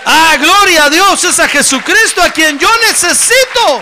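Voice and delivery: very loud, high proclamation; sustained high revival shout; exultant praise shout. Acted. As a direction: cry it out at full force to the whole church. Preacher voices Saul's exultant praise.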